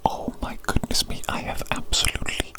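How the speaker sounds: ordinary talk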